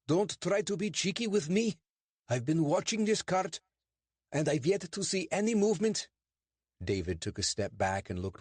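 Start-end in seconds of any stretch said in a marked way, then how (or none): 0.1-6.1 stern English constable, pitched much higher; a suspicious bobby lecturing two suspects; portly constable, stern and clipped. Acted. Constable scolds.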